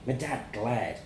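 plain reading voice

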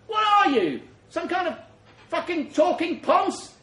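high, loud, mocking challenge; scornful yell. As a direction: sneer it over the gunfire. Soldier yells at talking corpse.

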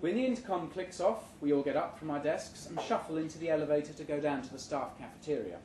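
usual speaking voice

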